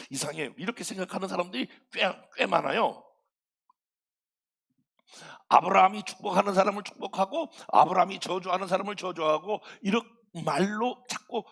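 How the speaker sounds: ordinary talk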